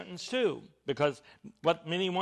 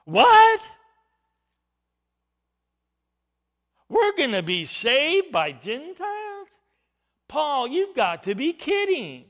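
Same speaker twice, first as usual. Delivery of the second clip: high, loud, acted disbelief; incredulous outburst; 8 semitones higher, 7 dB louder than usual. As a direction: shriek the first word, then protest in high-pitched astonishment.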